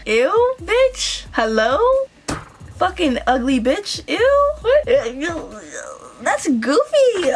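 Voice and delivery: high-pitched, incredulous ew; acted-out appalled reaction; disgusted disbelief. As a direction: recoil in exaggerated disgust, pitch leaping up. Acting out her disgusted reaction.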